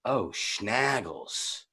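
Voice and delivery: very soft, very slow disappointed aside; deflated mutter. Retold or imitated. Finding a dice game instead of a party, soft slow letdown. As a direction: let it sink under your breath.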